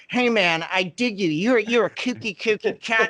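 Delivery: lightly voiced hipster entertainer. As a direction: give a hint of showbiz hepcat patter. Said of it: "Everything was [name]".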